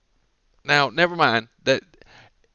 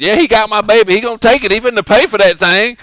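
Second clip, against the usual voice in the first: loud, high mock tirade; indignant mother; 8 semitones higher, 11 dB louder than usual. Mother phoning child support about the thief, mock outraged yell. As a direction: rant like a hammed-up wronged mom.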